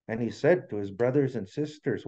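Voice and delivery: ordinary delivery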